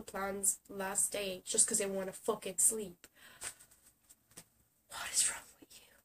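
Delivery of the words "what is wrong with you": whispered in disbelief; exasperated whisper. Real, not acted. Asking lazy plan-cancellers, whispered in disbelief. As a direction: hush it, stunned.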